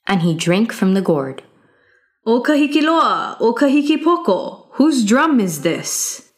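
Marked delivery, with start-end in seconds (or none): 2.3-6.3 higher, ringing call to the guards; young hero calling out. Acted. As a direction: call them out, bold and pointed. Youth calls to the guards.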